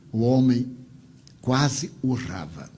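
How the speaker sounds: usual speaking voice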